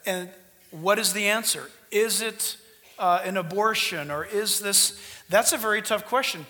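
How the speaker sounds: ordinary talk, nothing marked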